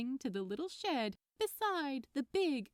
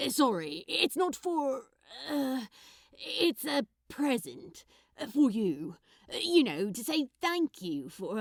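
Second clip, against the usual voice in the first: put-on loud, halting, flustered young man; nervous Crowley; 6 dB louder than usual. Nervous giver explaining the present, flustered and loud. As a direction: stumble through the thank-you, embarrassed.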